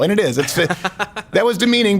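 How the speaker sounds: usual speaking voice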